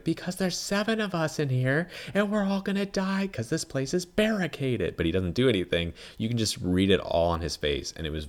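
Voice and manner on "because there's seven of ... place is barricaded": much higher, mock exasperated rant; mock the frustrated hero, voice pitched way up; exasperated Ben. Ben explaining to parents why the girl must go, high mock exasperation. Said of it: "He's like".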